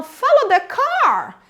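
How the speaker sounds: slow phrase, "car" lifted high then falling; rise on CAR. Shown as a demonstration. Teacher demonstrates sentence melody, rise on car. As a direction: go up on "car", drawn out. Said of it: "eu subo no car".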